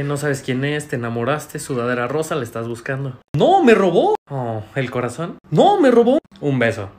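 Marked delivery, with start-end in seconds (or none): 3.4-4.2 loud, high-pitched correction; frustrated outburst. Acted. Correcting a romantic misreading. 5.5-6.2 high, loud protest; exasperated outburst. Acted. Correcting a misreading once more.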